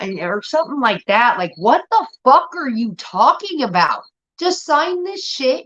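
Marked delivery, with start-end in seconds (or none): 1.6-5.7 much higher, slow, incredulous whine; a kid fed up with a spacey parent; exasperated teen. Retold or imitated. Teen self exasperated at mom.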